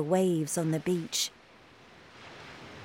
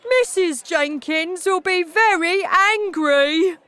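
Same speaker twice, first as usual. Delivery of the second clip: high, loud, drawn-out dismay; crying out; 11 semitones higher, 7 dB louder than usual. A narrator's reading. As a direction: wail the warning at full voice. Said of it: "cried Mister Jenkins".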